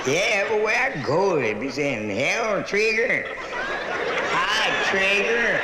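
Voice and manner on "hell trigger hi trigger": drawled greeting as Trigger; locals calling out. Retold or imitated. Locals greeting the comic by a horse's name, drawn out. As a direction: call out her new nickname, slow and friendly.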